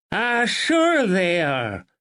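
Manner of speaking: put-on high, slow, muffled young man; muffled, eager and slow. Narrator voices Billy.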